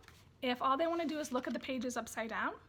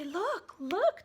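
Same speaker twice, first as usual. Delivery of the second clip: high rising look, look; calling attention; 7 semitones higher, 6 dB louder than usual. Shown as a demonstration. Parent pointing for baby, in a bright calling voice. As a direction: catch baby's eye with look, look. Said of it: "you say".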